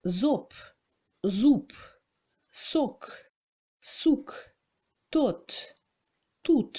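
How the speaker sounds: Zop against zoup, each vowel cleanly set apart; soc, souk, tot, tout as spaced Russian minimal pairs; contrasted O and OU. Shown as a demonstration. Teacher has learners compare O with OU, word by word.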